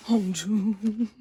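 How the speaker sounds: loud, near-tearful cry of the name; adoring wail. Real, not acted. Fan overwhelmed by favorite's photo, tearful wail.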